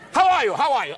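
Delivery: loud, high booming greeting; megaphone mother. Retold or imitated. Mother greeting everyone at full volume. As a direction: blast it like she holds a megaphone. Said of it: "like she had a megaphone".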